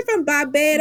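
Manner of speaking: hammed-up attempt at a Bajan accent; bad Barbados accent. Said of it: "That was a bad accent".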